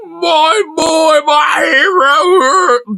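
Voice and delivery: high, slow, hammed-up crying; mock weeping. Streamer mimicking man crying at the speech, mock sobbing. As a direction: wail it like an adoring man in tears. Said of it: "is legit crying his eyes out".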